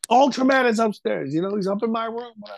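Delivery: put-on high-pitched, urgent retelling of a frightened kid; mock childish alarm, pitched well up. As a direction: imitate a panicked boy reporting to his dad. Frightened child telling father of intruder.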